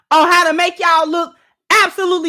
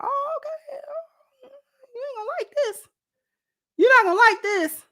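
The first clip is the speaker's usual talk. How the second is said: mock soft warning; 10 semitones higher, 5 dB quieter than usual. Mimics the man's calm warning.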